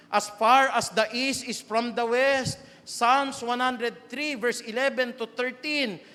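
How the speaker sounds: loud, slow preached scripture; emphatic scripture reading. Real, not acted. Preacher quotes scripture with force.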